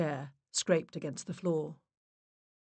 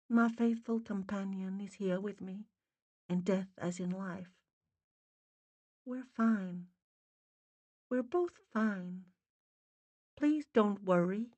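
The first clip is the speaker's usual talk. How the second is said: soft, higher-pitched spirit woman; faint and muffled; 3 semitones higher, 5 dB quieter than usual. A narrator's reading. Dead mother reassuring her family, faint muffled voice. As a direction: reassure gently, as if from beneath a pillow. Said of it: "faint and muffled as though the person".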